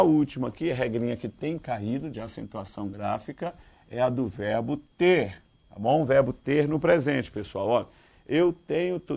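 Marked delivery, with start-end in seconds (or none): none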